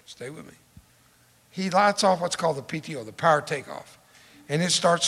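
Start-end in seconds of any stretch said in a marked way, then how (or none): 0.1-0.5 soft near-whisper repeat; hushed aside to the crowd; quiet repeat. Real, not acted. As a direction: draw them in softly.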